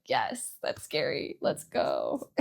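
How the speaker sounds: low, drawn-out, half-voiced little cheer; hushed mock cheer. Vlogger acting out seeing a loyal fan's comment, hushed mock cheer. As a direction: celebrate under your breath, slow and low.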